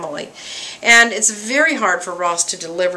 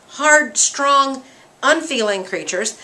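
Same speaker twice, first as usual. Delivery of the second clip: normal talking voice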